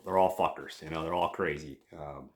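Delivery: ordinary delivery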